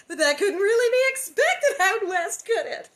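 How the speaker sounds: high, loud, haughty whine; snooty gushing. Acted. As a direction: sneer it out west snobbishly. Narration voicing Nellie's gushing.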